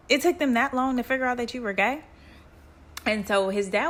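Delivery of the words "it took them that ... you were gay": mock disbelief, higher and quicker; incredulous. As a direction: rattle off a dad's astonished question. Dad repeats his incredulous question.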